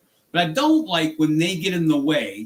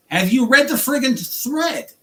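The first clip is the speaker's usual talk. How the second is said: exasperated outburst, higher and louder; furious; 8 semitones higher, 5 dB louder than usual. Real, not acted. Driver raging at Uber's canned replies, furious outburst. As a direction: let the fury burst out at them. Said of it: "I'm furious at this point".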